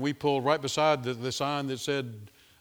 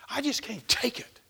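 high, weary whine; mock despair; 8 semitones higher than usual. A worn-out believer complaining, high and weary. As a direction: whine it like you've given up. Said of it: "mock despair".

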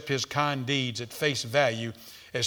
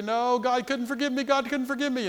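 despairing prodigal; 8 semitones higher, 3 dB quieter than usual. Acted.

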